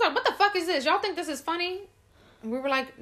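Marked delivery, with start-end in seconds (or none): 0.1-1.8 high mock indignation; indignant mother. Mother thinking the onesie is a joke, mock indignation. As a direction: challenge them in a raised imitated voice.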